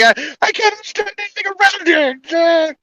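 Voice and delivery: high, soft whiny voice; mock confused expat. Expat at a restaurant, high helpless whine. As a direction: whimper it like a lost tourist.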